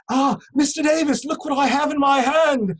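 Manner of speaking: high, beaming announcement; delighted guard. Retold or imitated. Guard brings the Queen's reply to the prisoner, high and delighted. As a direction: show off the letter like a proud gift.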